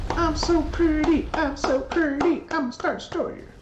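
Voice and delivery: very high, silly vain voice; playful falsetto self-admiration; preening starship. Pretend. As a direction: squeal it like a vain spaceship. Voices the star destroyer admiring itself.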